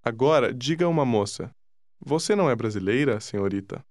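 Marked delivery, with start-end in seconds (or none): none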